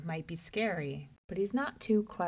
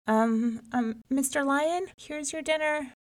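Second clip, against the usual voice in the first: higher, hesitant, timid offer; trembling voice; 7 semitones higher than usual. A narrator's reading. Rabbit at the lion's door offers himself, trembling. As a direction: stammer meekly before a feared master. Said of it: "said in a trembling voice".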